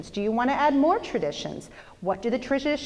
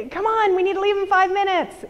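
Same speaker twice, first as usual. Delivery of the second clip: higher, hurried nudge to get out the door; impatient husband; 9 semitones higher, 4 dB louder than usual. Retold or imitated. Wife imitating her husband rushing them to church, impatient. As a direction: hurry the family along, a little raised. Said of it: "and Dan's like".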